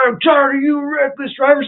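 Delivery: high, loud mocking yell of the angry driver; shrill-pitched parody of a furious bald man; mock angry screaming. The angry driver screaming at him, high mocking yell.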